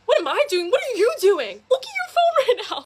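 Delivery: retold outraged confrontation; indignant retort. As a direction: throw the accusation right back, pitch climbing. Firing back in retold argument.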